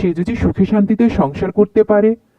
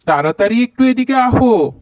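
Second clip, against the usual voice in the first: high-pitched calling out across the house to his wife; husband yelling for his wife to come quickly; bellowing for wife; 5 semitones higher than usual. Acted. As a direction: holler for her from another room. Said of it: "এরকম ষাঁড়ের মতো চিৎকার করছো কেন?".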